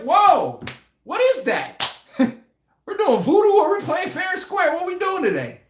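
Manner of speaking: hammed-up whoa, is this voodoo; mock shocked outburst. Streamer retelling seeing dust in the crowd, mock shocked shout. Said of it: "I'm like".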